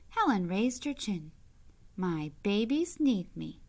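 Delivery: bright, lively storybook reading; reading to children. A narrator's reading. Reading a picture book aloud to kids, lively storybook voice. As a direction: read it out to the little ones with gentle delight.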